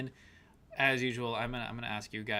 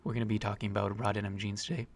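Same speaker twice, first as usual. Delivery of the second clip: ASMR voice; 4 semitones lower than usual. Pretend. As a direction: purr it like a hushed ASMR stream. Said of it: "we should switch to our ASMR voices".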